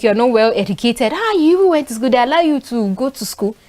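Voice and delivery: normal talking voice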